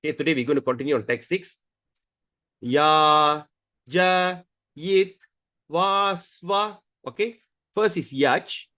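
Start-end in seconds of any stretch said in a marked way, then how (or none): 2.6-6.8 long, separated syllables; syllable-by-syllable demo. Shown as a demonstration. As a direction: sound out each syllable on a held note. Teacher sounds out verse syllables.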